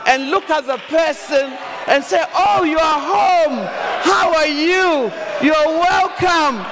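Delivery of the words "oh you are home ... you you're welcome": slow, loud, glowing welcome home; warm welcome. Shown as a demonstration. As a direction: greet your husband like he's the king. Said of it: "I learn to stop ... and say".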